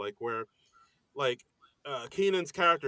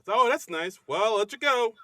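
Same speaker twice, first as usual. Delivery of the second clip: dad on the phone; 9 semitones higher, 5 dB louder than usual. Retold or imitated. Dad quickly ending phone call.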